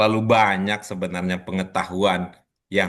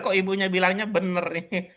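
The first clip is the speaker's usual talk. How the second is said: higher-pitched, words tipping into laughter; laughing; 8 semitones higher, 4 dB quieter, faster than usual. Real, not acted. Teacher teasing a student who called it correct, laughing. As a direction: say it through a real chuckle.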